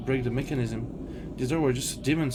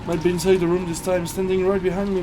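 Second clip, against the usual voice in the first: rising fear; 6 semitones higher, 6 dB louder than usual. Acted. Imagining the witch behind.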